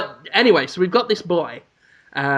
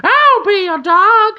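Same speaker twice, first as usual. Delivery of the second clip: high, slow cartoon crow voice from an old ad; advert crow voice; 6 dB louder than usual. Friend quoting the old ad, high crow voice. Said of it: "in the words of a racist Kia Ora advert".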